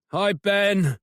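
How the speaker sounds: shivering, teeth chattering. Acted. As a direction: speak through chattering teeth. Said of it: "words come out through chattering teeth".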